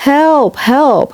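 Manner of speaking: high, desperate shout; cry for help. Acted. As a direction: call out in panic. James out of control.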